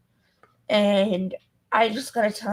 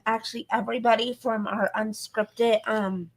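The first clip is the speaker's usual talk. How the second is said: ordinary talk, nothing marked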